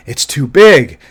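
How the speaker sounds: panting exhausted quote; out of breath. Retold or imitated. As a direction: gasp it out, spent. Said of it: "he's all out of breath".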